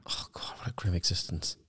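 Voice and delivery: whispered dread; very soft, breath-only groan of words; hushed dismay. Real, not acted. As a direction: whisper it under your breath, appalled. Hushed mock dismay at north side.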